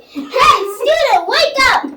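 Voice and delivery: high, loud mock teacher shout. Girl playing teacher yells at sleeping student, loud and high. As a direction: yell at the sleepy student to wake. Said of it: "I'm like".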